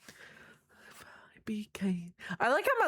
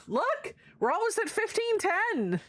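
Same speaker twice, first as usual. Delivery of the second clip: high excited voice; 12 semitones higher than usual. Real, not acted. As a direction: squeal about the milestone. Excited milestone.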